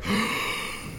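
sharp unvoiced gasp of disbelief; high breathy shocked gasp. Shocked at the slur.

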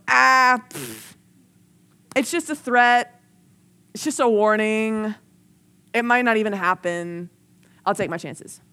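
loud scoff, then drawn-out brush-off; dismissive scoff. Acted. Preacher mimics churchgoers ignoring a warning, dismissive. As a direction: shrug off the warning, easing down at the end.

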